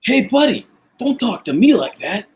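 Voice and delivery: put-on high, punchy New York accent. Accentist imitating people heard around the city, New York accent. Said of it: "New York accent".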